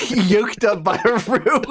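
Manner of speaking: quoting through laughter, slow; real giggling quote; laughing. Real, not acted. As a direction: savor the phrase while cracking up. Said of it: "my favorite phrase".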